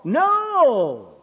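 long, high, falling retort; emphatic refusal. Retold or imitated. Preacher retelling his answer to the caller, emphatic refusal.

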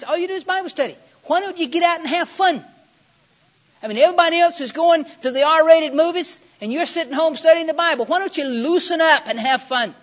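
loud, high, exasperated mock nagging; hammed-up, high-pitched badgering; scoffing friend. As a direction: imitate a peer pushing hard, voice way up. Acts out peer pressure from friend.

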